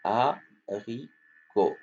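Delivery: Haricot drawn out slowly, the H silent, ending on a falling o; slow model word. Shown as a demonstration. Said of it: "On va pas prononcer le, le H".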